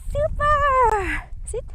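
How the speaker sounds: very high, loud cheer; thrilled praise. Real, not acted. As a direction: squeal it as a reward for the dog. Praising the dog while training.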